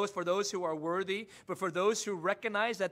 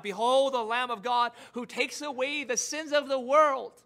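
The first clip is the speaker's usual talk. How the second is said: crying out; 4 semitones higher, 6 dB louder than usual. Retold or imitated. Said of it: "cried out".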